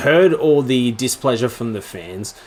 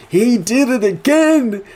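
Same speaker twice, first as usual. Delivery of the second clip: loud, slow, very high exclamation; astonished shout; 12 semitones higher, 5 dB louder, slower than usual. Retold or imitated. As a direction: stretch it out in loud disbelief. His reaction, escalating.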